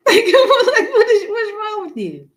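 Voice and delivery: high, loud laughing cry of disbelief. Real, not acted. Scolding the cat that bolted across the floor, high laughing disbelief.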